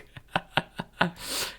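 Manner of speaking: quiet, breath-only laugh; soft chuckle. Real, not acted. Streamer tickled by own Chihuahua dog joke, soft chuckle. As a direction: chuckle softly, almost without voice.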